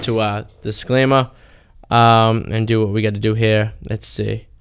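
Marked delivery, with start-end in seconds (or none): none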